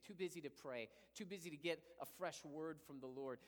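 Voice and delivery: normal talking voice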